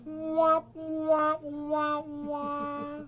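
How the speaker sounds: high sad trombone wahs; mock the teacher's look with sad wahs. Teasing Miss Christie's look, high sad trombone wahs. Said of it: "your eyes are going".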